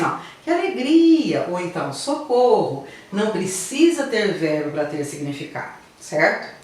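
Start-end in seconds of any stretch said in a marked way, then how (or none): 0.4-1.5 high, louder, delighted; joyful exclamation. Shown as a demonstration. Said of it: "Quando alguém diz assim". 1.9-2.8 higher, urgent plea; cry for help. Shown as a demonstration. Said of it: "Quando alguém diz assim".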